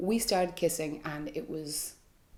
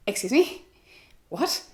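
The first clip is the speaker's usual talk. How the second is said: higher, louder stunned excuse me; shocked disbelief; 6 semitones higher, 3 dB louder than usual. Retold or imitated. Told by a coworker she deserved it, she answers in stunned disbelief. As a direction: react like you can't believe what you heard.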